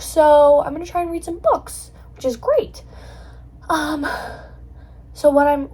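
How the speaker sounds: slightly winded talking; out of breath. Real, not acted. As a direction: speak a bit breathless. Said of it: "why am I out of breath?".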